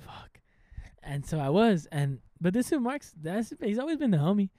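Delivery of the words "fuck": muttered curse. Retold or imitated. Retelling his own reaction.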